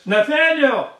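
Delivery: acted excited call to a friend. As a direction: hail him by name, pitched well up. Philip calls out to his friend.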